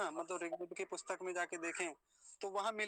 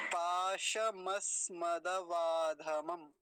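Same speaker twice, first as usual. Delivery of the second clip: पाशमस्मदवाधमम slowly, long vowels held; joined-up slow model; 4 dB louder, much slower than usual. Shown as a demonstration. Teacher shows how the whole word is formed, slowly joined. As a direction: join the parts into one measured word. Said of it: "ऐसे".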